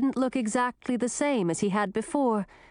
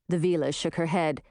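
usual speaking voice

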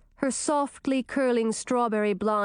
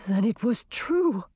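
hoarse. A narrator's reading. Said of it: "his voice hoarse".

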